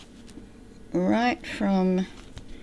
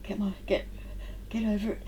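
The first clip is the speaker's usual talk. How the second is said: straining before a sneeze; held breath, sneeze building; about to sneeze; 3 semitones higher, 5 dB quieter, faster than usual. Real, not acted. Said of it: "I'm going to sneeze".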